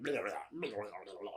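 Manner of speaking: low, quiet imitated gurgle; soft mock blubbering, sinking away; melting gremlin noises. Imitating Stripe melting in sunlight, low burbling.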